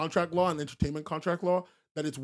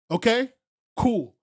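usual speaking voice

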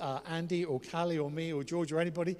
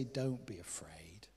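slow, low near-whisper; soothing; 6 semitones lower, 8 dB quieter, slower than usual. Acted. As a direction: hush a frightened friend gently. Quoting Jesus's comfort.

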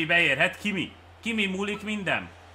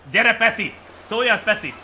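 loud, higher cheering on; rousing shout; 6 semitones higher, 7 dB louder than usual. Real, not acted. Gamer urging Peti to push, rousing shout. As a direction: spur the batter on at full voice.

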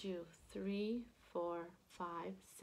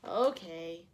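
sighed; 3 semitones lower, 7 dB louder than usual. A narrator's reading. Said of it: "sighed Lulu".

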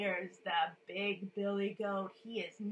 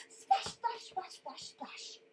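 hissy splashes, fading away; big splash noise; 7 semitones higher, slower than usual. Shown as a demonstration. Teller making water noises with children, splashing and fading. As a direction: start high, let the splashes die down. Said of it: "Can you make a big splash noise".

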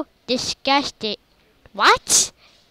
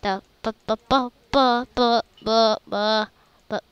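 ordinary delivery

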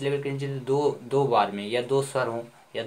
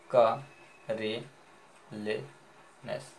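broken into syllables; much slower than usual. Shown as a demonstration. Teacher breaking the word up, का, रे, ले, नेस spaced out. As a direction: leave a clear pause after each of का, रे, ले, नेस. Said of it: "तोड़ दें".

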